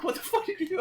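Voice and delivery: high, loud incredulous question with laughter; mock shocked outcry. Imitating friends after he leapt from the car, shocked.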